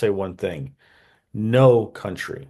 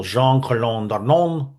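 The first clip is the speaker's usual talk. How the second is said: a clumsy attempt at a French accent on the name; bad French accent; 3 semitones higher, 3 dB louder than usual. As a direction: try to say the name the French way, badly. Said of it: "and that's a horrible accent".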